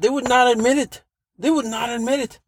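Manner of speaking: high-pitched, emphatic protest; exasperated insistence. Real, not acted. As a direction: stress each word in disbelief. Exasperated repeat, much higher pitch.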